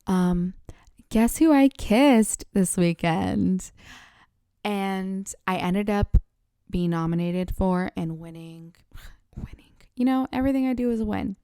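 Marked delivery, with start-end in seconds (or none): 1.1-3.7 excited. Retold or imitated. Teasing gossip reveal. 8.2-9.7 whispering. Real, not acted. Whispered aside.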